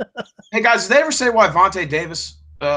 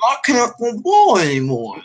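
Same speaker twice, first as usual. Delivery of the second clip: high, slow, dopey voice; high slow dopey mock athlete voice; mocking Eli Manning impression; 8 semitones higher, slower than usual. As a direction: drag it out like a dim jock. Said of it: "He goes".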